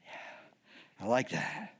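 low, hushed smug pleasure; flattered ego. Acted. Corinthians enjoying Paul's flattery, quietly smug. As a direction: soak up the praise, low and soft. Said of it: "it's feeding the ego".

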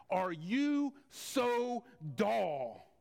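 slow, drawn-out rebuke; Jesus asking. Acted. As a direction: stretch it out like a weary teacher. Jesus voiced reading scripture.

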